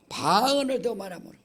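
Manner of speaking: ordinary delivery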